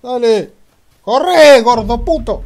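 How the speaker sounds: high, loud taunting yell. Real, not acted. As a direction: shout at him to run. Yelling at fleeing enemy.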